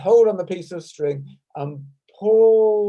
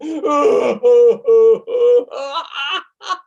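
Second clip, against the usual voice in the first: mock sobbing dropping then loud high laughter; hammed-up weeping that flips to laughter; cry down, laugh up; 7 semitones higher, 8 dB louder than usual. Shown as a demonstration. Teacher acts out crying down and laughing up, mock sob to laugh. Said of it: "cry down and laugh up".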